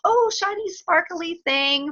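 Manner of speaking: high, slow dreamy wonder at something shiny; lightly voiced distracted child; mock distracted delight. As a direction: gasp at a shiny toy like a magpie. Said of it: "I go".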